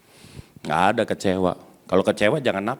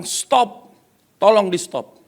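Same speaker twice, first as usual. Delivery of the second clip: high-pitched, insistent call to stop; imitated yelled demand; 9 semitones higher, 4 dB louder, slower than usual. Preacher voices the guest ordering the pianist to stop, high insistent yell. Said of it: "dia teriak, dia berdiri".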